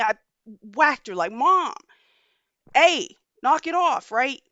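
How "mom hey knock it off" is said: higher, louder telling-off; scolding. Retold or imitated. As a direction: sharply order your mother to stop. Scolding mother after whacking her.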